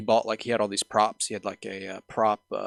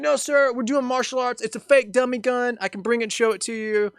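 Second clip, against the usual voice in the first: high, raised pleading explanation, acted; urgent calling out; 13 semitones higher, 5 dB louder than usual. Friend explaining the fake gun to the guard, acted urgent call. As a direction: call across to placate a guard.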